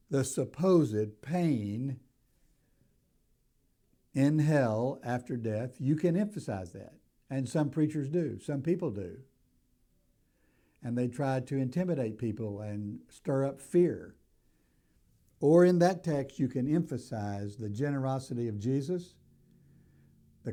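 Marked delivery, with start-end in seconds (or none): none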